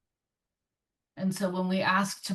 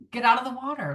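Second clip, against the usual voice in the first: louder, higher burst of alarm; urgent warning; 6 semitones higher, 6 dB louder, much faster than usual. Acted. Body warns the swimmer of a shark, urgent warning. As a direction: call out a sudden danger signal.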